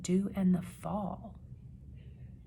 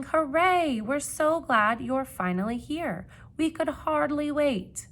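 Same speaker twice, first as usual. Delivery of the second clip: joyful calling out; 8 semitones higher, 8 dB louder, slower than usual. Acted.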